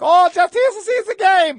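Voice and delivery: high, loud fake German voice; mock German accent. Pretending to be German to dodge angry fans, mock German accent. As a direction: put on a silly German accent to hide. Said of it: "pretending we're German".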